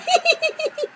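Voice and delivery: very high, soft mock giggle; giggling. Sister giggling at the shocked look, high squeaky giggle. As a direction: imitate a squeaky, helpless titter. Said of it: "she's just giggling".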